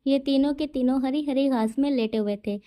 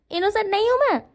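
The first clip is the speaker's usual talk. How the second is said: pouty denial; 7 semitones higher, faster than usual. A narrator's reading. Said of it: "wing pout बना लेता है".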